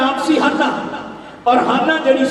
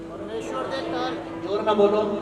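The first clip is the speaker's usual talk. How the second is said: softer call for a response; low-key prompt; 3 semitones lower, 9 dB quieter than usual. Real, not acted. Preacher asks congregation to answer, low-key prompt. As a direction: quietly prod them to speak up.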